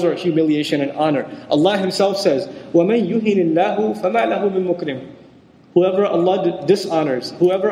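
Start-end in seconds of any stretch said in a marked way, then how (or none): 2.7-5.1 measured Arabic scripture recitation; Arabic verse quoted in recitation style; Quran recitation. Acted. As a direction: recite the verse in a reverent teacher's cadence.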